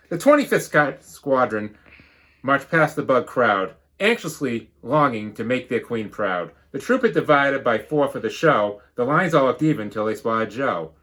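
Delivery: lively storybook read-aloud; bouncy rhyming storybook narration; reading to children. Acted. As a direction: read it like a picture book to the class. Teacher reads picture book.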